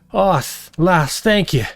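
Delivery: put-on grateful dwarf in a thick Scottish-style accent. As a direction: thank her in a thick Scottish-style brogue. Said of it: "her accent strange and thick".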